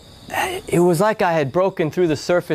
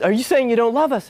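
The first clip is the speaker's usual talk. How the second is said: sister's upset question; 9 semitones higher than usual. Retold or imitated.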